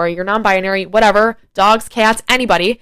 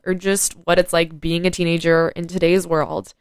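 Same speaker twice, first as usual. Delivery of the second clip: ordinary delivery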